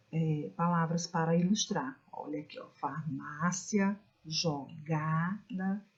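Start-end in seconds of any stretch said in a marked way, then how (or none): 2.7-4.0 "farmácia" slowly, MÁ drawn out; stressed penult. Shown as a demonstration. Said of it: "da sílaba forte". 4.2-5.8 "jogada" very slow, GA held long; stressed penult. Shown as a demonstration. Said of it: "da sílaba forte".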